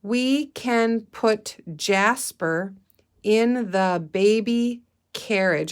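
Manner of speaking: slow, word by word reading; slow read-aloud. Shown as a demonstration. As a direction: read it slowly for the class. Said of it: "Let's read that sentence".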